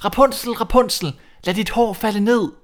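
prince calling up. Acted. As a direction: call for her from below. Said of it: "om aftenen og råbte".

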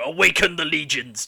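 hammed-up Necron command; slipping into character. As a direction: proclaim it like an overlord waking an army. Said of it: "accidentally slip into character".

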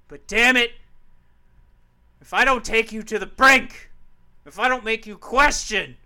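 loud, fired-up wrestling promo. Pretend. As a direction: yell it at your opponent. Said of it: "I wanna try and cut a promo".

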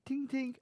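high pitched tink tink like glass; high tink tink mimicking a knife on glass; tink tink tapping noise. Imitating glass tapping sound.

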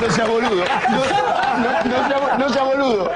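mock-scold him through laughter; imitating Bilardo. Retelling his coach's reprimand, lightly voiced and laughing. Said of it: "Me dijo".

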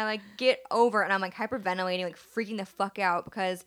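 ordinary talk

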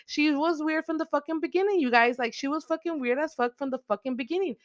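high-pitched, outraged excitement; real heated rant over a plot twist; worked-up vindication. Real, not acted. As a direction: burst out high as if proven right. Outburst at the killer reveal.

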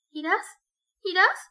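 high, rising anxious plea; trembling. A narrator's reading. Sonia pressing him to go confess, trembling. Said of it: "Preguntó temblorosa".

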